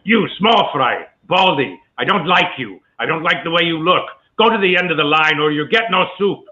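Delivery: put-on high, scolding Middle Eastern accent; militant Middle Eastern vendor. Actor ad-libbing for his comedian friend as the Soup Nazi, militant Middle Eastern accent. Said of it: "ad lib in that voice".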